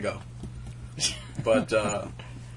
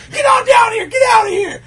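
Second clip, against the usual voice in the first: big yelled order, much higher and louder; shouting him away; 12 dB louder than usual. Retold or imitated. Friend yelling at him to leave the bar door, loud shout. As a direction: bellow at him to clear off. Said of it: "you kept telling me".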